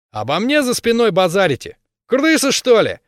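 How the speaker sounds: high, loud thuggish yell; a brawler spoiling for a fight; berserker shouting. Acted. Bully accuses them.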